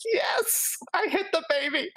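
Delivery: very high-pitched triumphant cheer; excited squeal. Real, not acted. Player finally lands the hit, gleeful high squeal. Celebrates a successful dice roll.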